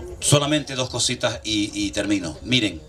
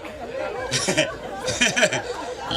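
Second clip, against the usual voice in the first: breathy, unvoiced laugh; soft laugh; 4 semitones higher, 4 dB quieter than usual. Real, not acted. Pastor amused by his own anecdote, soft laugh. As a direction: let a quiet laugh slip out.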